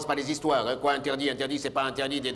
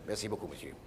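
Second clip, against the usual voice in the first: quiet; 9 semitones lower, 13 dB quieter than usual. Acted. Thanking someone in the crowd.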